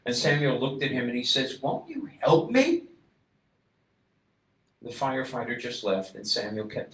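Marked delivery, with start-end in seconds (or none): 1.6-2.8 much higher, pleading; desperate plea. A narrator's reading. Father begs the firefighter for help, pleading high. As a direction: beg for help.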